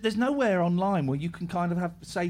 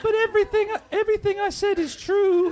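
man doing a woman's voice; 11 semitones higher than usual. Pretend. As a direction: still in the high girl voice. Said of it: "Can anyone do a really good girl's voice?".

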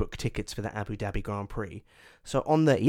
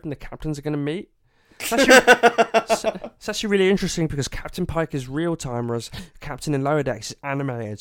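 nerdy fact guy; 2 semitones higher, 4 dB louder than usual. Pretend. Parody of a nerdy chat-up.

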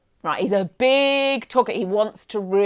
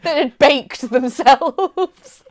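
drawn out, slow, laughing through it; amused disbelief, slow; slow laughing line; 5 semitones higher, 6 dB louder, much slower than usual. Real, not acted. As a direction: laugh the words out slowly.

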